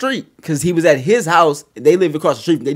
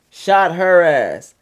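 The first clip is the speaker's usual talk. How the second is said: slow drama; 4 dB louder, much slower than usual. Acted. Dramatic slow story climax.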